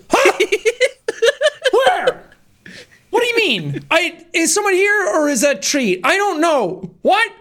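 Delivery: high, puzzled dog voice, a laugh breaking in; confused dog voice. Retold or imitated. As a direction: voice a dog hunting for a visitor who isn't there. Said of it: "And they're like".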